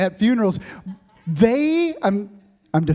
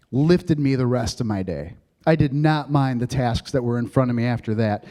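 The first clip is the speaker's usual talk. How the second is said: ordinary talk, nothing marked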